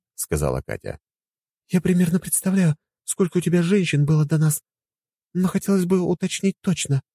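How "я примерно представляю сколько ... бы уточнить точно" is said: higher woman's voice, probing; inquisitive wife. Acted. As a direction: probe him firmly. Katya voiced. (настойчиво)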